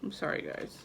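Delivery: whispered apology; whispering. Real, not acted. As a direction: breathe it out as an aside. Apologizing, unprepared, aside.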